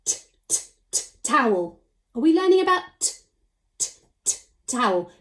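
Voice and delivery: "t, t" as a crisp voiceless t, then "towel"; segmenting. Shown as a demonstration. Teacher sounding out the first letter for children. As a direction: clip out the "t" sound before the word. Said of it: "What does towel begin with?".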